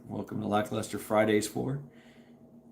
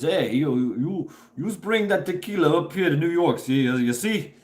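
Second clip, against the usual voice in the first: mock New York mobster voice, higher and louder; goodfellas gangster; 6 semitones higher, 4 dB louder than usual. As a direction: ham up a wiseguy calling someone over. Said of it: "the good fellas".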